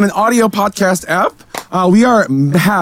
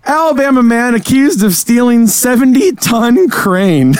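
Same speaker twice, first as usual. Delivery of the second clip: headline read; 4 semitones higher, much slower than usual. Acted. Reading the game headline.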